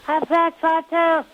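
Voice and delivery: very high, drawn-out cartoon balloon voice; high-pitched lovable weather balloon character; Fats Von Toon. Shown as a demonstration. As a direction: squeak it out slowly, far above your own voice. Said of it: "I used to do a high voice".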